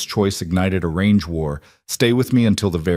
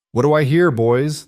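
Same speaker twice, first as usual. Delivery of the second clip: higher, louder auctioneer's call; crowing trader; 6 semitones higher, 3 dB louder than usual. A narrator's reading. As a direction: crow it out to get the bidding started. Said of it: "the trader crowed".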